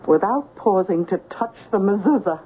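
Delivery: ordinary talk, nothing marked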